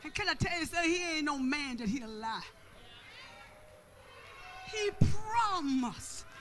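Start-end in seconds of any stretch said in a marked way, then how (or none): 4.7-6.2 high, slow, stretched-out proclamation; long-held preacher's cry, falling at the end; emphatic preaching. Real, not acted. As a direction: draw the words out with rising force. Preacher stretches the repeated promise.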